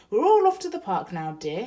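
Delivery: loud, high and hearty; cheerful announcement. Acted. Husband announcing the park trip to his wife, loud and jolly. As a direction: boom it out to the whole room. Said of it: "he announced".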